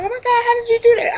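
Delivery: high, fast gushing disbelief; imitated amazement. Ladies marvelling at the nanny, imitated amazement. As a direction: mimic astonished onlookers in a high voice.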